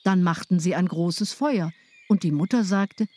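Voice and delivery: ordinary delivery, nothing marked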